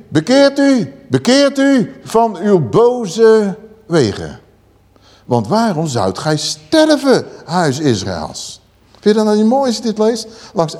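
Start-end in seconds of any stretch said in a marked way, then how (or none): 0.1-8.6 prophetic call. Acted. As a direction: call the people back slowly and urgently. Reads the call to repent. (smekend, langzaam profetisch roepen)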